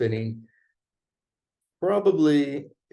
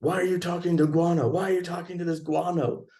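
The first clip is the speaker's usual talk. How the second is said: mock angry woman; 6 semitones higher than usual. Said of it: "she kept calling me guano".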